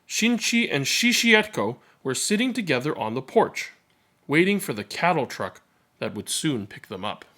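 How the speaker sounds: ordinary delivery, nothing marked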